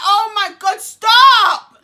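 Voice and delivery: very loud, high screamed plea; re-enacted scream for it to stop; retold scream. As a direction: belt it out, peaking on the last word. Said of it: "I screamed so much".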